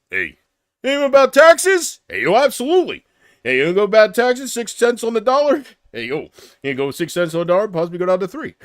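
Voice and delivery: hammed-up boxer voice, hard to make out; Stallone. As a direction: imitate Rocky blundering through a debate. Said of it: "You couldn't beat Stallone".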